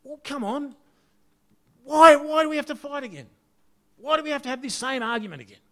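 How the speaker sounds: hammed-up frustrated why at a relative; exasperated family row. Family member fed up with the same old argument, mock exasperated.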